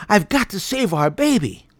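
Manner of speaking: desperate. A narrator's reading. Father desperate to save baby.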